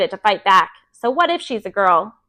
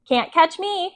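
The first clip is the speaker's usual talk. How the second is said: put-on high, teasing little-kid call; a light toddler's singsong taunt; 7 semitones higher, slower than usual. A narrator's reading. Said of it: "he hollered".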